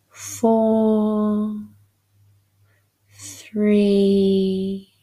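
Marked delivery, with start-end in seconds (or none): none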